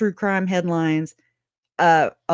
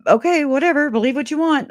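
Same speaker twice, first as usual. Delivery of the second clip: high mocking dismissive brush-off; dismissive mimic; 8 semitones higher, faster than usual. Mimicking how she dismissed Colby, high and scornful. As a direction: mimic her waving Colby off, pitched high. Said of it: "how she told Colby".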